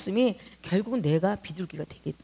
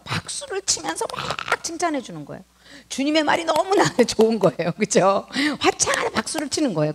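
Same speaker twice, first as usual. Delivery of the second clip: excited; 6 semitones higher, 4 dB louder than usual. Real, not acted. Lord clapping and praising.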